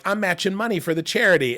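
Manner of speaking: chirpy retold self. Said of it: "And I'm like".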